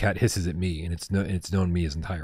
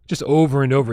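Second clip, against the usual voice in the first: ordinary talk, nothing marked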